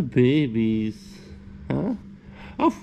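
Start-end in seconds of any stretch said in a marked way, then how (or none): none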